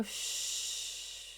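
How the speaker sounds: soft, airy shh trailing away; long hushing exhale. Shown as a demonstration. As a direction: let all the air hiss softly out.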